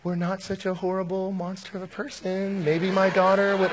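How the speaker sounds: mock sweetness with a jab underneath; passive-aggressive. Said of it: "It was all passive aggressive".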